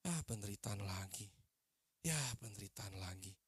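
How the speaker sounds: low, soft, resigned grumble; weary complaint. Acted. Preacher mimics believers greeting fresh suffering, weary complaint. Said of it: "nggak bilang gini".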